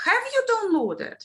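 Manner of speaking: "downloaded" asked with a high arch falling away; question prompt. Shown as a demonstration. Teacher posing a prompt question to trainees, high-pitched arching question. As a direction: pitch "Have you downloaded" high, then let it drop. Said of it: "where do I put the stress".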